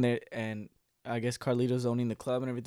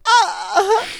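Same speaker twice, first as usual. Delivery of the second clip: high, loud comic cry; mock pained yelp; 11 dB louder than usual. Lalin's hair grabbed by Carlito, mock yelp. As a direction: yelp high, half laughing.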